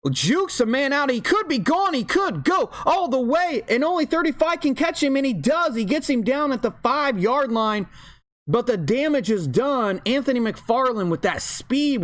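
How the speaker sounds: high, urgent breakaway call; excited play-by-play. Real, not acted. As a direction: ride the long run at a high pitch. Live call of a breakaway run.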